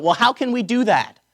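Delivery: high, loud heckler from the back; crying out from a crowd. Acted. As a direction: call it out over a multitude. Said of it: "somebody out in the back of the multitude cries out".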